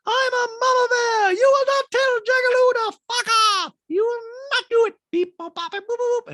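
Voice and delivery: very high, loud hammed-up shrieking; over-the-top squawk breaking into noises; mock mama-bear scream. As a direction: screech it like a cartoon angry parent. Recapper mimics PK and Dorit's outburst.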